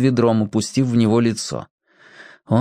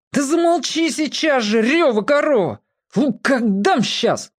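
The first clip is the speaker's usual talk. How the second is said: exasperated shout; 12 semitones higher than usual. Acted. Brother shouts at crying sister.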